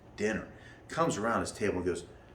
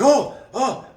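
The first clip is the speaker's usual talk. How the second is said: high, loud mock startled cry; mock startled man leaping back; startled yelp; 11 dB louder than usual. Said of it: "He's like".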